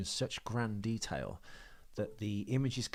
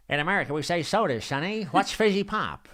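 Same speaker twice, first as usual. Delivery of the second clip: put-on old-timey American accent; 7 semitones higher, 7 dB louder than usual. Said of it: "speaking in a nineteen forties voice".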